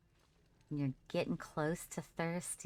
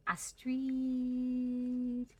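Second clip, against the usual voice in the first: drawn-out call; 10 semitones higher than usual. Pretend. Calling out a character's name.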